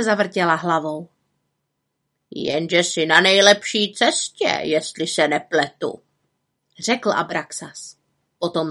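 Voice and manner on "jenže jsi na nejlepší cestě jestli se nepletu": lightly voiced bird, slowing the warning; admonishing raven. A narrator's reading. Raven cautions the witch, lightly admonishing. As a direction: warn her with a touch of raven croak.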